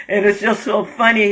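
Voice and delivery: words through a laugh, voice cracking. Real, not acted. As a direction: laugh it out as you talk. Laughing at his own story.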